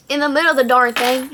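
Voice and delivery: Texas accent. Real, not acted. Correcting a swear word, strong Texas accent. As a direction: lean hard into a Texas accent. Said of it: "That Texas accent goes crazy".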